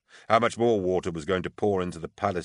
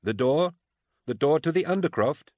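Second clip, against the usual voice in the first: raised, high-pitched questioning call; 9 semitones higher, 4 dB louder than usual. A narrator's reading. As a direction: call back up, querying what was heard. Shouted exchange up the stairs.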